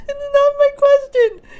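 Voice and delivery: very high, laughing protest. Real, not acted. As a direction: squeal it out laughing.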